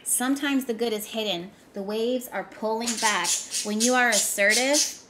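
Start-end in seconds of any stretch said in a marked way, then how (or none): 3.6-5.0 forceful. Real, not acted. Repeats line, first loud.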